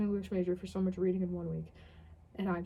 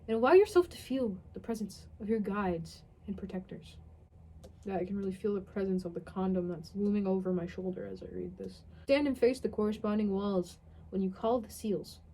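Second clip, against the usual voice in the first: ordinary delivery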